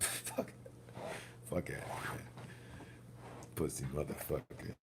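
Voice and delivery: muttered curse. Real, not acted. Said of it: "muttering to himself after laugh".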